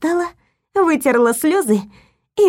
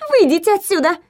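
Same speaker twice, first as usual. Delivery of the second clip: nurse's voice; 6 semitones higher than usual. A narrator's reading. Caught nurse orders Lilya out.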